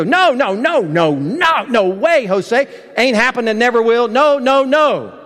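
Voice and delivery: high, insistent string of no's; acted-out adamant denial; emphatic refusal. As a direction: shut it down completely, swooping on each no. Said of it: "what we call an emphatic "no".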